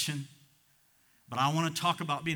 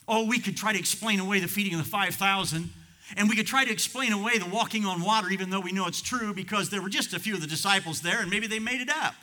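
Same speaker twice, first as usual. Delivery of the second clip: higher, driving sermon delivery; forceful preaching; 5 semitones higher, 5 dB louder than usual. Real, not acted. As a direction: build the argument in punchy waves.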